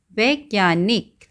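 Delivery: slow, spaced-out वै-ज्ञा-निक; syllable by syllable. Shown as a demonstration. Teacher giving children the answer to write, syllable by syllable. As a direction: draw out each syllable of वैज्ञानिक.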